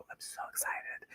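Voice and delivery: barely-there breathed whisper; excited whisper. Real, not acted. Creator confiding in viewers about the coming video, excited whisper. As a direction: whisper it like a thrilled aside.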